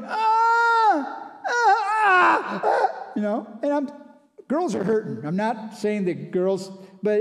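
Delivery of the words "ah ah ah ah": imitated labor cries, very high and loud; cow's birthing bellow. Imitating the cow dropping her calf: high, loud pained cries. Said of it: "that old cow was going".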